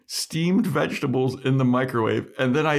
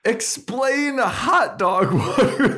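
laughing; 8 semitones higher than usual. Real, not acted. Mocking incredulous repeat, laughing.

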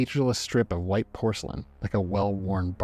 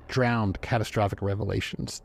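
ordinary delivery

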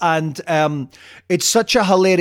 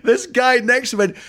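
ordinary delivery

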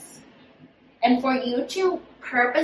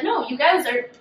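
ordinary talk, nothing marked

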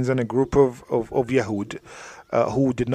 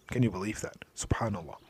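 whisper; 6 semitones lower, 15 dB quieter than usual. Real, not acted. Marvelling aside to listeners.

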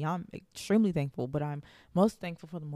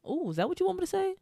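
much higher, surprised "ooh" as an idea lands; surprised realization; 13 semitones higher, faster than usual. Real, not acted. Host asking God for guidance, suddenly surprised. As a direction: catch the thought with a surprised ooh.

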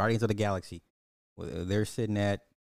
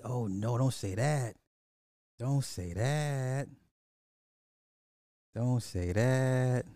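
higher-pitched, slowly stretched plea; drawn-out dismay; 4 semitones higher, much slower than usual. Real, not acted. Streamer answering a viewer who panned Spider-Verse, drawn-out dismay. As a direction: protest slowly, stretching out the words.

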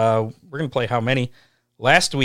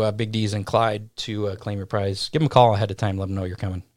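usual speaking voice

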